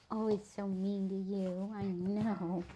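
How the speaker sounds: usual speaking voice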